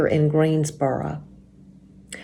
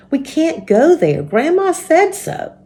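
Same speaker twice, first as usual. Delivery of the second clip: put-on higher pitched child's voice, insisting; a little girl reminding her friend of the rules, pitched higher; young Joan protesting; 9 semitones higher than usual. A narrator's reading. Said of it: "said Joan".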